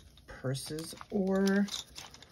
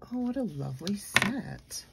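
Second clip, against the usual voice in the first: usual speaking voice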